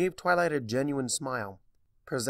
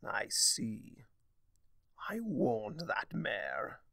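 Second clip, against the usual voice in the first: glowering; 5 dB quieter, slower than usual. A narrator's reading. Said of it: "Discord glowered".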